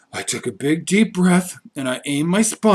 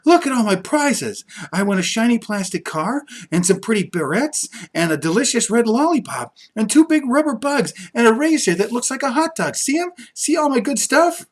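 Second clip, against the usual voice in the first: put-on high, eager girl showing off her prizes; a high, excited little friend; 7 semitones higher than usual. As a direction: brag high and bubbly about every prize. Grace shows off prizes.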